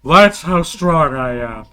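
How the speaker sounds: dim brute. Acted. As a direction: boast like a proud dimwit. Dim brute boasting, headache.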